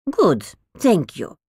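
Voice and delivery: put-on grand, foreign-accented old lady, indignant; dotty old lady full of disdain; eccentric old woman. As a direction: scoff at the barbarians who bin good records. Old woman voiced in the story.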